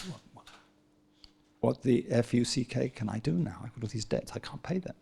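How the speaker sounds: retold debtor begging his patron in a hushed voice; hushed desperate plea. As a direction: drop to a hushed, panicky plea. Hennessy begging Disraeli over debts.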